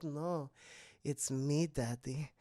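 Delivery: low, soft creepy Esther voice.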